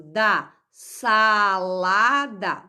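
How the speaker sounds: sa-la-da, very slow, each syllable stretched; slow syllable split. Shown as a demonstration. Teacher sounding out salada for the class, slowly. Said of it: "Então, sa".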